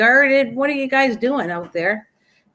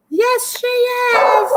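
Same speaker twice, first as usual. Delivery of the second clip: pet talk; 12 semitones higher, much slower than usual. Real, not acted. Talking to her loud puppy.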